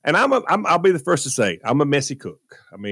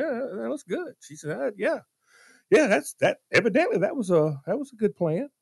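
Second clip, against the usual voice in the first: grudging praise; 9 semitones higher than usual. Retold or imitated. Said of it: "She goes".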